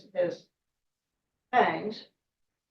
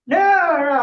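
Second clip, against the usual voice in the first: high, loud, whiny no; boy protesting he won't release the snake; frantic refusal; 9 semitones higher, 8 dB louder than usual. Acted. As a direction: cry out against letting go.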